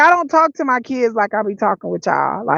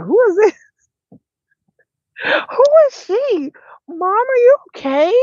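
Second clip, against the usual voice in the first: put-on kids alarmed; 10 semitones higher than usual. Kids shocked at mom, high child voice. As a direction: play the startled kid. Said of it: "they'd be like".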